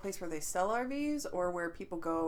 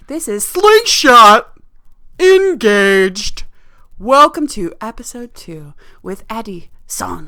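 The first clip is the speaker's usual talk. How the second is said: loud, slow announcer intro; 19 dB louder, slower than usual. Acted. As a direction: announce the show like radio.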